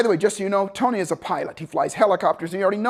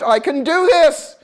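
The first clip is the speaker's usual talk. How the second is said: laughing confidence; 8 semitones higher, 6 dB louder, much slower than usual. Retold or imitated. Excited self-talk, laughing.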